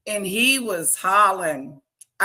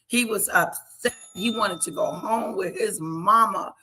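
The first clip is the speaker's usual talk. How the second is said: ordinary talk, nothing marked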